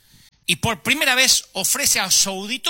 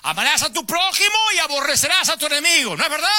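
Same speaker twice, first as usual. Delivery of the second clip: Jesus preaching on the mountain; 9 semitones higher than usual. Acted. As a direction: project it to the last person on the hillside. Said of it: "fuerte para que lo escuchara el último". (Jesús predicando a gritos en la montaña)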